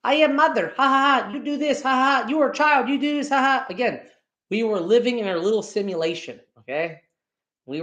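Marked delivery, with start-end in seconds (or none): 0.1-3.7 high, hammed-up bossy mom voice with a cackle; mock bossy mother. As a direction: play the domineering mother, cackling. Mocking his controlling mom.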